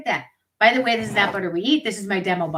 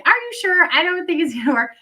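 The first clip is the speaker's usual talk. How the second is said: higher, unsure doubting quote; mock the owner's hesitant disbelief, pitched up; doubtful gym owner; 8 semitones higher, 4 dB louder than usual. Imitating the gym owner doubting the cloths, higher and unsure. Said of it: "she didn't say it like that".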